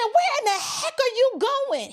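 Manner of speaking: high, exclaiming mock-officer demand; indignant officer. Officer to driver pulled over for speeding, indignant.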